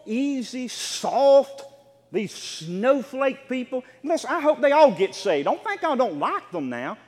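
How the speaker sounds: high, loud sermon at full force; fiery preaching. Acted. Pastor driving his point home to the church, fiery preaching.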